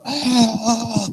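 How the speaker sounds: high drawn-out "aah" war cry; mock battle cry. Preacher imitates armies revving up, mock battle cry. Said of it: "start getting their battle cries".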